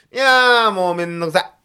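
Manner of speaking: exasperated whine that starts high and loud. Real, not acted. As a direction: burst out fed up with the hassle. Groans about the costly hassle.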